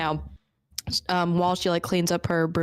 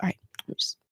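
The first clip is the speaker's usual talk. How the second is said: whispering; 6 dB quieter, faster than usual. Retold or imitated. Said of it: "She's like".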